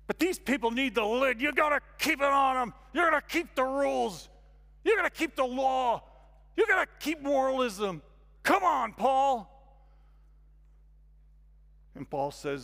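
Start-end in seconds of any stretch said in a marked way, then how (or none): 0.1-9.5 high, loud, hammering demands; insistent shouted protest. Acted. Judaizers demand Paul keep the law, shouting. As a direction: press each rule on him forcefully, shouting.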